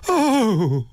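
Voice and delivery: high, poignant cry; a moving, high-pitched "oh"; full-throated cry. A narrator's reading. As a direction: cry out high, moved to the core. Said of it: "A full-throated cry, poignant, moving".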